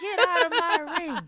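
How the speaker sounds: loud, high mock yell; yelled catchphrase. Imitating Stephanie yelling in the ring, loud. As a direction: bellow it like there's no mic. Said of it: "she's yelling 'cause there's no mic".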